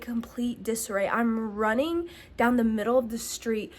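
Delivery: normal talking voice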